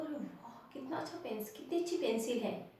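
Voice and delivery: soft acted admiration; hushed wonder. Shown as a demonstration. Teacher admires someone's nice pencil, in hushed wonder. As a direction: marvel softly, as if impressed. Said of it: "आश्चर्य से".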